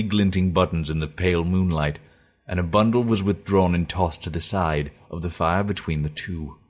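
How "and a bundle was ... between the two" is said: ordinary delivery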